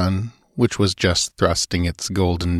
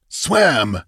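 higher, indignant exclamation; angry exclamation; 5 semitones higher than usual. A narrator's reading. Master echoes the stranger's answer, angrily. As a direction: throw the word back in angry disbelief. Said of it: "exclaimed the master angrily".

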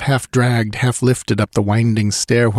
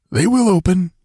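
high, tense voice of the guide; frightened guide Tekodl; 9 semitones higher than usual. A narrator's reading. Tekodl answers Conan without looking back, high and tense. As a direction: pitch up high for the nervous guide.

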